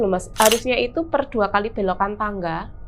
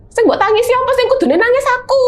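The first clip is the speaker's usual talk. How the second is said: loud, much higher complaint; fed-up yelling at the ghost; exasperated retort; 13 semitones higher, 6 dB louder than usual. Retold or imitated. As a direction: protest that you are the one who should cry. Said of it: "aku bilang gitu kan".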